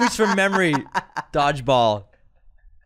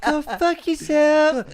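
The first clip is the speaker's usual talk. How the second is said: high, very slow drawn-out insult; quoting Les Grossman; 13 semitones higher, 4 dB louder, much slower than usual. Retold or imitated. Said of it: "repeats the movie line".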